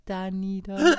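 the snail finishes at her own pace; slow snail ending; sluggish snail. Acted. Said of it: "mit ihrer gewohnten Trägheit".

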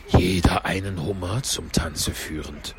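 low, slow Gryphon voice; Gryphon interjecting. A narrator's reading. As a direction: drop deep for a slow aside. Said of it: "rief der Greif". (tiefe, langsame Greifenstimme)

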